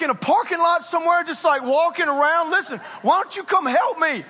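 usual speaking voice

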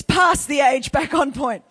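loud preacher's call; emphatic call. Real, not acted. Preacher calling the congregation back to his verse, loud. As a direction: punch it out loudly to the room.

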